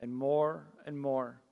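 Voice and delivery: soft, low opening prayer. Acted. Pastor praying softly before the sermon. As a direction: pray quietly, leading the church into the sermon.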